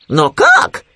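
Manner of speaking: high, loud puzzled question; surprised Khryunya. A narrator's reading. Khryunya hearing Winnie's plan, surprised piping voice. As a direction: ask in high amazement. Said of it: "удивился Хрюня".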